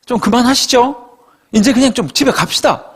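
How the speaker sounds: high, fed-up Gyeongsang plea; mock-annoyed Gyeongsang grumble; Gyeongsang dialect. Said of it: "경상도 말로".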